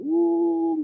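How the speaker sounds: high soft ooh; soft dismayed ooh. Real, not acted. High exclamation.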